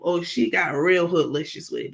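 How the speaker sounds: ordinary delivery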